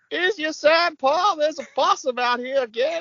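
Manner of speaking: high, hammed-up rustic bumpkin accent; country bumpkin. Friend mocks how Max sounded, a high country bumpkin accent. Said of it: "country bumpkin".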